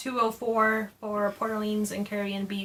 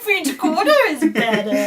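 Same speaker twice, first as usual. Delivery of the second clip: said through a laugh; 7 semitones higher, 8 dB louder than usual. Real, not acted. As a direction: laugh while insisting. Laughing, playful comeback.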